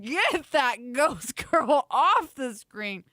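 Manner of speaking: slow laughing shout. Real, not acted. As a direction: laugh through the words. Laughing at screen.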